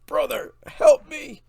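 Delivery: wounded warrior's hammed-up cry for help. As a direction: beg your brother for rescue as you fall. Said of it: "leaving him crying out".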